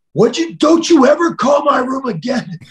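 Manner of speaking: high, loud furious yell down the phone; angry shout. Retold or imitated. Tired wrestler yelling at a pestering fan over the phone, furious. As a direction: bark it like you've had enough. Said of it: "And I got angry".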